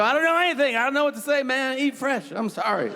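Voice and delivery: high, flustered pleading; panicked denial. Pretend. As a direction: babble nervously, trying to escape blame.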